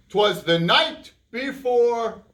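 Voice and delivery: put-on slow, drawn-out reading to children; storybook reading. A narrator's reading. Host reading the poem aloud to kids, slow storyteller. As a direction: read it slowly for the kids.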